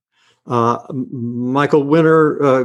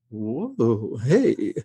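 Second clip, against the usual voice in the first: giddy wonder; 4 semitones higher, much slower than usual. Retold or imitated. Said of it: "and I'm going".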